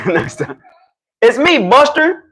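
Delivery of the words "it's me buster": calling out. Real, not acted. Still calling at locked door.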